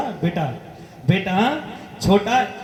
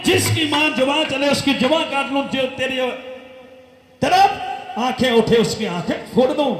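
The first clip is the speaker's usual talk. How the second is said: fierce vow; 6 semitones higher than usual. Acted. Son swearing vengeance for his mother, fierce and high. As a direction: hurl it out as a furious son's oath.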